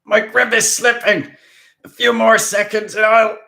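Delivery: high, very loud straining cry; desperate shout. Acted. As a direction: shout as your grip fails. Haas straining, grip slipping.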